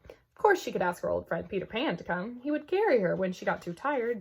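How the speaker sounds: ordinary delivery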